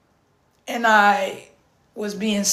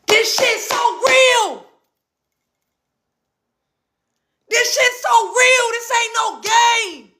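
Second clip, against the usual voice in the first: much higher, forceful shouting; passionate outburst; 10 semitones higher than usual. Real, not acted. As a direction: hammer it at the viewers like a wake-up call. Fervent outburst to viewers.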